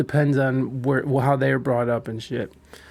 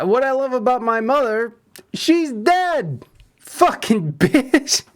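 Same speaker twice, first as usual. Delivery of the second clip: mocking quote; 9 semitones higher, slower than usual. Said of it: "she's like".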